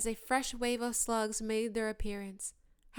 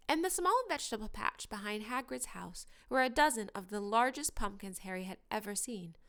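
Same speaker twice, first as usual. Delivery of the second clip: plain reading voice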